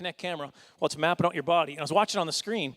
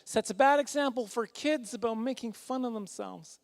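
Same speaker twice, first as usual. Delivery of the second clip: higher, softer complaining lady voice; offended lady; 5 semitones higher, 5 dB quieter than usual. Acted. Offended lady scolding the comedian after a show, put-on voice. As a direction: put on an offended audience member, pitched up. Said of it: "she was offended".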